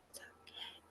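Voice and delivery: barely audible whisper, imitating her; hushed, gentle whispered imitation of a team leader; soft, careful reassurance. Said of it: "she was just softly, carefully saying".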